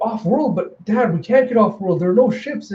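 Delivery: put-on high, incredulous girl voice; protesting daughter. Daughter protests to her father.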